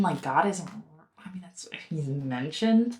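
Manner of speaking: ordinary talk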